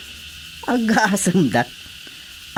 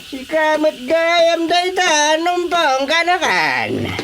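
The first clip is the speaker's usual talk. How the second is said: teasing drawn-out; 8 semitones higher, slower than usual. Acted.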